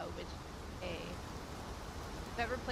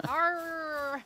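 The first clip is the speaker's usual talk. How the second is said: loud, high mock pirate "Arr"; 6 semitones higher, 7 dB louder than usual. Echoing a friend's pirate act, loud and playful. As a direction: echo a playful pirate roar. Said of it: "in a pirate voice".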